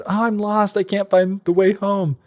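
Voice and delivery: put-on high, worried little boy; lost boy pleading; scared boy voice. Sven voiced in story.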